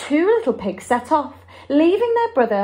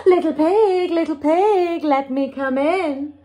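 raised, loud singsong wolf call, falling at the end; 4 semitones higher, 4 dB louder than usual. Acted. Wolf at the house of sticks, calling in singsong.

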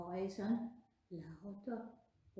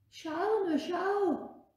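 excited showing; 9 semitones higher, 6 dB louder, much slower than usual. Acted. Leute zeigen Geschenke.

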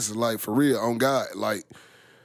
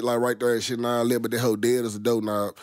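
ordinary delivery

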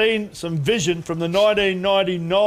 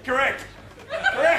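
laughing; 9 semitones higher than usual. Real, not acted. Mocking wrong answer with laugh.

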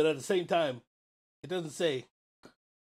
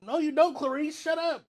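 higher, loud playful mockery; loud teasing; 9 semitones higher, 4 dB louder than usual. Real, not acted. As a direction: shout down the claim playfully. Mocking the energized wristband claim.

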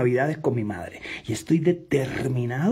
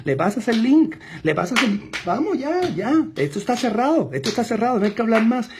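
urgent closing push; 7 semitones higher than usual. Acted. Urging the client to close now.

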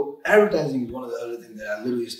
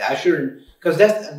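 normal talking voice

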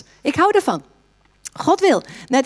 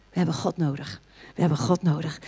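low, much softer plea; hushed emphasis; 5 semitones lower, 8 dB quieter than usual. Real, not acted. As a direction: drop right down and press it gently. Pastor drops voice for emphasis.

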